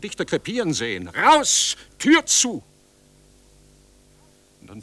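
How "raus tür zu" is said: screamed orders, much higher and louder; frantic full-throated scream; furious shout. Acted. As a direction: yell at the crowd to get out and shut the door. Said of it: "schrie ich".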